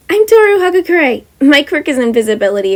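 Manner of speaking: high, loud, cheery; perky invisible girl. Acted. Invisible girl introducing herself to the teacher, high and perky. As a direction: introduce yourself with a grin.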